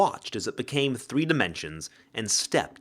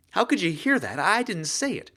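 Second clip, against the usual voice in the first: higher, alarmed disbelief; startled heroine; 8 semitones higher than usual. Acted. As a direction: caught off guard, rattled. Penn startled.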